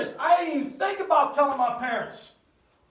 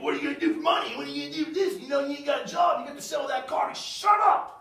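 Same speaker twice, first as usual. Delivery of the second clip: higher, rapid scolding that peaks on the last words; dad yelling imitation; 3 semitones higher, faster than usual. Dad scolding his son about money, mock yelling. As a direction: imitate a father's tirade, building to a yell. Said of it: "My dad's sitting there yelling at me".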